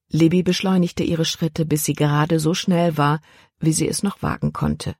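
usual speaking voice

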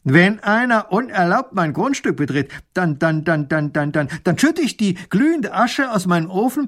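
put-on old man threatens the visitors, angrily stammering; angry old man. A narrator's reading. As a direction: sputter with rage, tripping on dann. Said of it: "wird der Alte richtig böse".

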